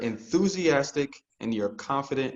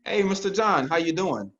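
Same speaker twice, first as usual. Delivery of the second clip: upbeat, higher greeting that assumes you know him; confident friendly greeting; 6 semitones higher, 4 dB louder than usual. Shown as a demonstration. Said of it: "You're assuming it's Mister John".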